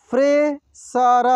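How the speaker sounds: Fresher drawn out slowly, syllable by syllable; slow sounding out. Shown as a demonstration. Teacher slowly sounds out fresher for students. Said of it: "एक एक मात्रा को अलग अलग करके".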